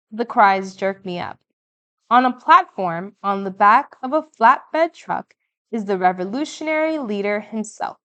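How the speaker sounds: plain reading voice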